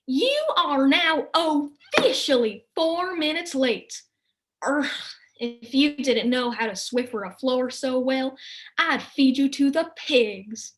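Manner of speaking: slow, loud scolding stepmother; angry mother. Acted. Mother scolds Angelica for being late, loud and slow. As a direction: snap at the late daughter. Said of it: "an angry Arbelfraster".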